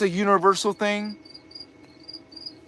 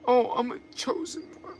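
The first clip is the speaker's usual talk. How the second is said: higher, softer, smug mock voice of a proud chosen one; breathy-free sing-song mockery, pitched up and hushed; 7 semitones higher, 7 dB quieter than usual. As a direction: parody a seeker gushing over being chosen. Mocking someone who feels chosen.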